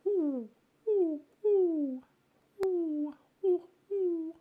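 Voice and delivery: high, sliding oohs; high ooh calls. Acted. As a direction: hoot ooh in a high pure tone. Imitated high noise.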